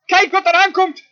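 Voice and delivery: loud, high cry of alarm; Mozes crying out. Acted. Moses sees Pharaoh coming and cries to God, crying out. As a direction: call up to God in mounting panic. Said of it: "Begin te roepen".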